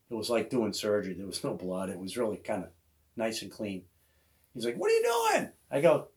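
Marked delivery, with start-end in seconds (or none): 4.8-5.5 Kenny voiced. Kenny voiced in retold hunt story.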